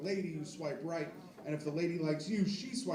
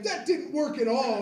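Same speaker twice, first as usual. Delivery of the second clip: louder, higher mock complaint; 6 semitones higher, 6 dB louder than usual. Son calling dad back, loud complaint. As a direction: whine it back at him. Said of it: "I was like".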